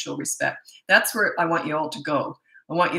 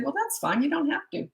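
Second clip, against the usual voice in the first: higher, airy and unbothered; light breezy calm; 10 semitones higher, 4 dB quieter than usual. Shown as a demonstration. To the refusing kid, light airy unbothered calm. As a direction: say it lightly, no fight at all. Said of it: "I said".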